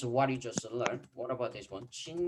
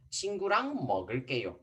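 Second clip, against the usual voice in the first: fast model sentence; much faster than usual. Shown as a demonstration. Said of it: "this one".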